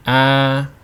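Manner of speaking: long, steady "Ah" on one pitch to the end; sustained flat tone. Shown as a demonstration. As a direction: hold "Ah" perfectly level, neither rising nor falling. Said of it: "Think of sustaining a musical note".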